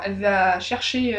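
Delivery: ordinary delivery, nothing marked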